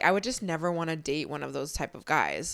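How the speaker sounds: usual speaking voice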